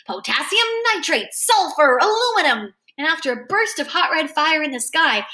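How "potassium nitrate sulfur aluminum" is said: high, sing-out list; excited shouting out. A narrator's reading. Freak names chemicals in the sky, excited calling. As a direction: call each name out, pitched up. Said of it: "shouting out the names of chemicals".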